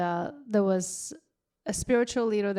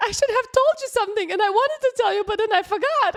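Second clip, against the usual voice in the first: much higher, quick, loud mock friend; high mock girlfriend voice; 13 semitones higher, 7 dB louder, faster than usual. Vanilla girlfriend confessing on the phone, high, quick mock voice. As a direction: ham up the guilty friend. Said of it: "And she said".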